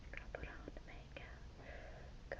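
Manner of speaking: whispered to herself while concentrating. Real, not acted. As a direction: breathe the words under your breath. Whispering while applying makeup.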